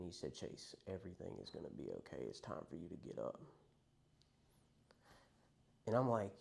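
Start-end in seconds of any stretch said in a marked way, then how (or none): 0.3-3.4 soft, lower, soothing reassurance; calmest voice. Retold or imitated. Friend reviving Chase after an overdose, softer, calm and reassuring. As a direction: gently bring him back, softer and lower. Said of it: "in the calmest voice you could possibly imagine".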